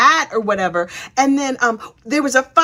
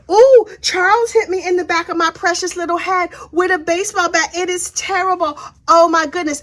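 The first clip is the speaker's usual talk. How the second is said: higher, hammed-up victim whine; mocking a staged sob story; mock whining; 7 semitones higher than usual. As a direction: play the fake injured victim. Mocking Alex's staged excuse.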